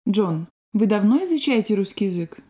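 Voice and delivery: ordinary talk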